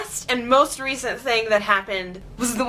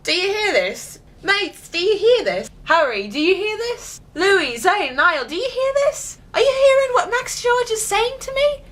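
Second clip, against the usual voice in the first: rising higher with each call, indignant; 9 semitones higher than usual. Retold or imitated. [name] calling his bandmates over the insult, mock outrage rising higher. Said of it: "[name] must have been like".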